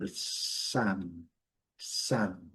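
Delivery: psalm with a drawn-out a, slow and quiet; long a in psalm. Shown as a demonstration. Teacher drawing out the vowel of psalm. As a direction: stretch the a of sam slowly. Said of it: "E o A, mais longuinho".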